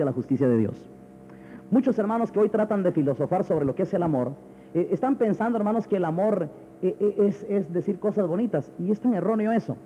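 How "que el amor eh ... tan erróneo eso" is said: ordinary talk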